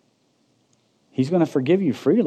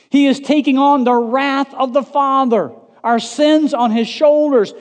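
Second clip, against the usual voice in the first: high, loud pulpit shout; full-force preaching; 10 semitones higher, 7 dB louder than usual. Real, not acted. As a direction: preach at the top of your voice.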